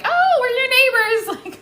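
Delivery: high, slow, laughing neighbor gush; excited neighbor. Retold or imitated. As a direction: stretch it out like a starstruck neighbor. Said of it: "two people there that were like".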